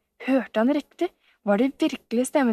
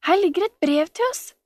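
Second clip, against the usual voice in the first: high-pitched announcement of a find; excited calling out; 8 semitones higher than usual. A narrator's reading. Camilla finding a letter, calling to Sofie. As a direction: call them over, pitched up. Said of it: "ropte Camilla".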